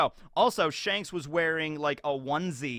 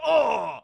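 pained grunt; 7 semitones higher, 7 dB louder than usual. Acted. Whitebeard hit in chest.

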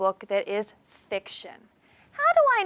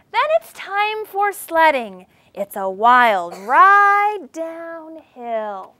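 starts high, then slows right down on the ride; sledding page read with a swoop; swooping read-aloud; 6 semitones higher, 8 dB louder, much slower than usual. Acted. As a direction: let the voice sled down the line.

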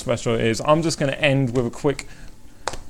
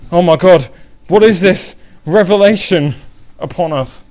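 loud, higher theatrical outcry; mock drama; 6 semitones higher, 8 dB louder than usual. Streamer begging viewers to reveal it, mock-dramatic outcry. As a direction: proclaim it with hammed-up awe.

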